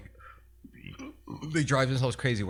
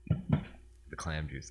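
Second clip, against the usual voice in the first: hushed savoring; 5 semitones lower, 6 dB quieter, much slower than usual. Real, not acted. Savoring the clam taste.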